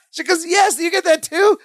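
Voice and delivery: high, eager shout of recognition; finally someone else gets it too; excited. Retold or imitated. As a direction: leap on it with delight. Jennifer excited, shout measured.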